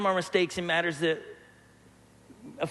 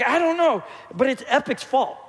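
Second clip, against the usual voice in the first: mock excuse; 4 semitones higher than usual. Son blaming his brother for the milk, high mock whine. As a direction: play the son dodging blame, pitched up.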